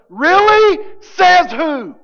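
loud, high disbelieving shout; sharp loud incredulous challenge. Real, not acted. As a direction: fire back in disbelief. Preacher challenging a claim.

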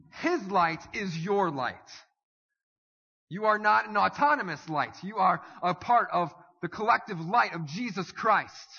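preaching. Acted. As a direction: preach it with conviction to the congregation. Preacher drives home the point.

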